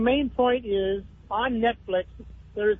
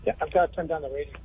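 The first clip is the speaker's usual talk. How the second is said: phone held away; 6 semitones lower, 4 dB quieter, faster than usual. Real, not acted. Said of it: "I can't really hear you well".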